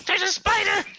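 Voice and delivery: high shriek of fright; screaming at a spider. Real, not acted. Sees spider in game.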